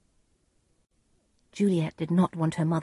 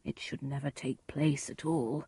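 hushed aside; quiet, grave musing; private thought; 3 semitones lower, 10 dB quieter than usual. A narrator's reading. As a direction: think it to yourself, very softly. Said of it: "thought the friar".

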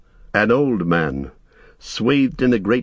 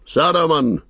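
the second call pitched higher and more insistent; the wizard's insistent repeated summons; louder, higher commanding cry; 7 semitones higher than usual. A narrator's reading. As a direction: raise the call higher to demand an answer. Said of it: "he cried in a loud, commanding voice".

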